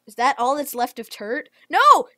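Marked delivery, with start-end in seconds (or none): 1.7-2.1 high, loud cry of no; real dismayed shout; shocked no. Real, not acted. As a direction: yell it in dismay. Friend eaten in game.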